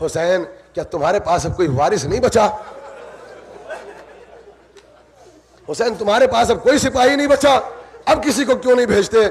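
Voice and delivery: high, jeering enemy call; mock Hussain from the enemy ranks; taunting army. Akbar retells the enemy army taunting Hussain, high jeer.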